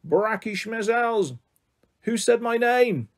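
high, slow, drawn-out alien call; tinny alien voice. A narrator's reading. Mysterious figure calls through a voice changer, alien tone. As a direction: stretch each word like an alien calling out. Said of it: "with the tinny metallic alien tone they had heard before".

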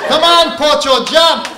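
high loud jeer; taunting shout. Acted. Hawaiians taunting the Portuguese lady, high loud jeer. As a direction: call him down mockingly.

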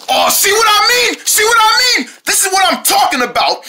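high-pitched, exasperated I-told-you-so; alarmed protest. Pretend. Sark warns siblings about danger traps, alarmed. Worrier vindicated after danger.

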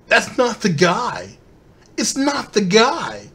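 slow, sarcastic drawl; slow, sneering mock of the excuse; mocking quote. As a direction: parrot the excuse with scorn.

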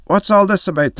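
storyteller's voicing of an irate priest. A narrator's reading. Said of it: "the priestess getting angrier than ever".

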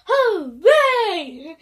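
big loud cheer; shouted hooray. Pretend. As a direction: shout it out, stretching the last syllable. The song calls for shouting.

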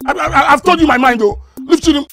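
high, loud ultimatum; angry threat. Acted. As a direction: shout a final warning at your rival. Son threatens father over woman.

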